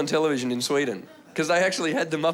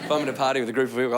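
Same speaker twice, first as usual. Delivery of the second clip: usual speaking voice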